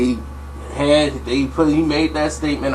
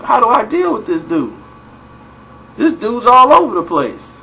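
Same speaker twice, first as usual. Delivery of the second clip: higher, louder exasperated complaint; a frustrated teammate venting, pitched up; exasperated Charles; 8 semitones higher, 4 dB louder than usual. Retold or imitated. Voicing Charles complaining to Mike.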